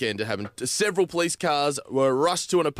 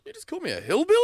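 indignant; 13 semitones higher, much faster than usual. Retold or imitated. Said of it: "I'm like".

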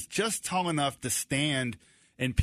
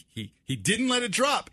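ordinary talk, nothing marked

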